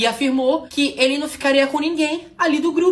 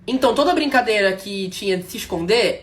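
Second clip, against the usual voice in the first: usual speaking voice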